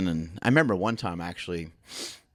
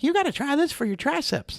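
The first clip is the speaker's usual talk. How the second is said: much higher, coaxing put-on voice; mock coach voice. Coach pitching an exercise to a visitor, high mock voice. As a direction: sell the new exercise like a grinning trainer. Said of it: "he'd be like".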